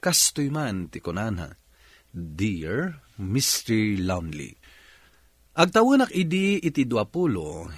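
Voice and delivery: ordinary talk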